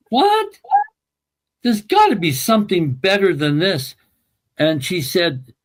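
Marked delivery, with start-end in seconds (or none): 0.1-4.0 retold high-pitched, incredulous "what", then insistence; emotional retold objection, pitched higher; emphatic disbelief. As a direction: protest in disbelief, voice jumping up. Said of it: "And I was very emphatic".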